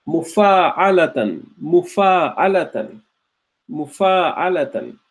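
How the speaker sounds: slow, Mufa'alatan with a long aa; slow model pronunciation. Shown as a demonstration. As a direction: say Mufa'alatan slowly for students to copy. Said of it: "So I would go slowly".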